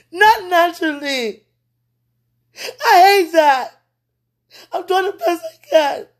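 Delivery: high, loud tearful protest; crying it out, worn down. Real, not acted. Overwhelmed woman pleading with God through tears.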